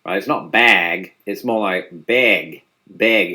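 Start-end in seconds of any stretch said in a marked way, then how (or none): 1.9-3.3 drawn-out "beg", the vowel raised to eh; bag as "beg". Shown as a demonstration. Presenter shows Northern Midwestern "bag", said as "beg". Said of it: "they pronounce the word bag".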